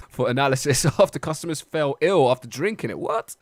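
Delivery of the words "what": shocked, much higher what; surprised. Real, not acted. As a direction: squeal of disbelief. Reacting to news.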